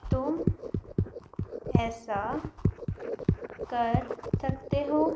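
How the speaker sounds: slow, paused word by word; teacher dictating the meaning. Shown as a demonstration. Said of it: "क्या बोलना है".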